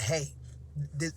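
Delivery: ordinary talk, nothing marked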